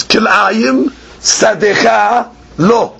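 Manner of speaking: slow, with "Kil'ayim" pulled onto "sadecha lo"; a deliberate new phrasing of the verse; regrouped reading. Shown as a demonstration. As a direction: read "Kil'ayim sadecha lo" slowly as one phrase. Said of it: "and read it like this".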